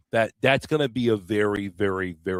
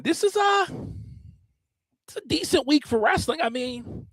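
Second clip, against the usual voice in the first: high squeaky voice. Acted.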